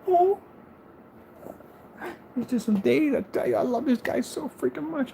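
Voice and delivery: adoring, squeaky pet voice; gushing affection. Real, not acted. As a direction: coo over your beloved pet in a high voice. Gushing over a pet on camera.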